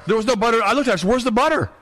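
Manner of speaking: rising outrage, voice jumping up on where's the butter; indignant outburst. Real, not acted. Telling his friend about the fake butter, pitch climbing in outrage.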